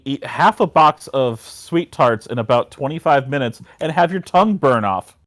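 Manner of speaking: normal talking voice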